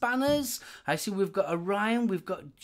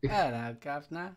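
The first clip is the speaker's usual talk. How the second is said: mock British accent; 5 semitones lower than usual. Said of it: "with a British accent".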